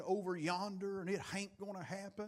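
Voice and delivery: softly imitated Appalachian country woman; a hint of mountain Appalachian speech; light Appalachian accent. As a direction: quote her in a quiet Appalachian twang. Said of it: "Hans has this Appalachian accent".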